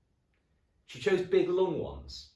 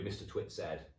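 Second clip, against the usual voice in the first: plain reading voice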